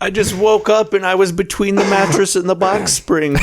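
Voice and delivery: hammed-up helpless girl; mock stuck damsel. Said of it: "stuck under the coffee table".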